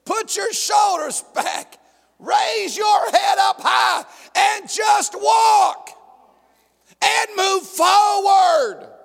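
stirring high shout to move forward; rousing command. Acted. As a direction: lift them up and send them marching. God encouraging believer.

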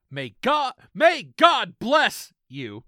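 loud, high, drawn-out blessing; hammed-up blessing. Reading a scam email's sign-off to co-host, grandiose mock blessing. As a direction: boom it out with mock piety.

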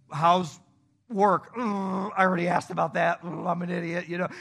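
loud, halting small talk, then cringing; acted-out awkwardness. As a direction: flounder out loud, groaning at yourself. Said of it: "And I was awkward".

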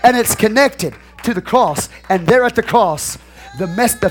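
slow proclamation building to a high shout; preaching. Acted. Pastor linking the angel to the cross, fervent shouted preaching. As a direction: build relentlessly to a shouted peak, then ease off.

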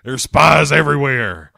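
loud, alarmed mock warning; mock paranoid outburst. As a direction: call it out like a paranoid crank. Retelling a paranoid character's outburst.

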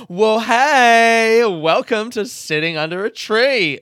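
high, loud cheesy host voice; chirpy radio presenter. Pretend. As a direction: sell it like a morning radio DJ.

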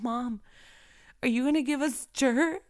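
a hint of a wide-eyed child; curious kid voice. Quotes her son Adrian.

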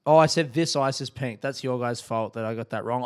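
ordinary talk

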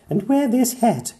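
bright bossy little doctor; Pearl's voice. A narrator's reading. Pearl telling the mermaid what to wear. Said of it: "she said".

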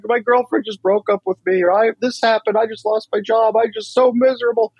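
high-pitched mock panic, pouring out woes; panicking buddy. Panicking buddy calls for help after a breakup, high mock panic. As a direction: imitate a friend freaking out, pitch way up. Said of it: "they're freaking out, panicking".

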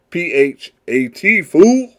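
high, loud "fool" with a rise; slangy street voice. Retold or imitated. Podcaster spelling the slang in a loud high voice. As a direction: spell it out then yell "fool" playfully.

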